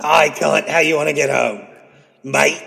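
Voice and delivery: British sliding into Australian; hammed-up Australian-British mate talk; muddled British accent. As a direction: greet them in a dodgy British-Australian accent. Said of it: "Am I Australian or British?".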